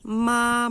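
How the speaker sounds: "ma" long, high and level; slow sustained Mandarin first tone on "ma". Shown as a demonstration. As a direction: hold "ma" steady at a high pitch. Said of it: "A gente teria".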